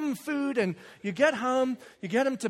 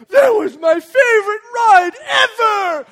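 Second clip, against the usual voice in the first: put-on slow, very loud high kid shout; thrilled four-year-old boy; 12 semitones higher, 15 dB louder, slower than usual. Son to dad after the ride, thrilled shouting. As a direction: bellow it like an overexcited small child. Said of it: "He gets off the ride and goes".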